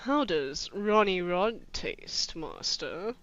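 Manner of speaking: low, slow, hushed comic elf; funny Dobby voice. Acted. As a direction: do a silly, creeping servant elf, drawn out. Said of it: "I'm gonna do a funny voice for Dobby".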